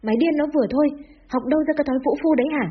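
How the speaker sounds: high-pitched indignant rebuke; scolding yell. Acted. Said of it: "hẩy bố ra mà quát".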